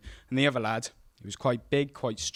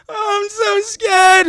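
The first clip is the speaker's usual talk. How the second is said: much higher, louder, slow crying whimper; whiny scared kid; 12 dB louder, much slower than usual. Retold or imitated. Frightened boy stuck on monkey bars, crying high and slow. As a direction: wail it like a terrified child.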